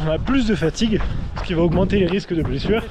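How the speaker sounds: ordinary talk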